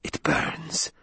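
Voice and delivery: pained whisper through the burning; a boy's whispered cry of pain. Acted. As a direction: breathe it out in pain. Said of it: "he whispered".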